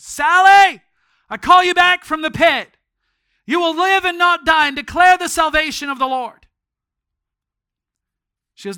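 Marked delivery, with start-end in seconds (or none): 0.0-6.5 higher, loud commanding cry; commanding prayer. Retold or imitated. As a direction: call her back like a command from heaven. Sally heard the prayer on the street.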